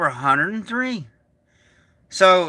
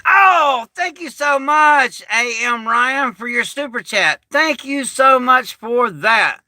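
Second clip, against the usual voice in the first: excited gratitude; 4 semitones higher, slower than usual. Real, not acted. Streamer thanking viewer for super chat, excited gratitude. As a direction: gush your thanks, drawing the words out.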